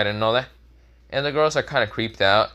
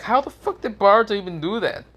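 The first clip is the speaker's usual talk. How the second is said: disbelief; 10 semitones higher than usual. Acted. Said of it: "They're like".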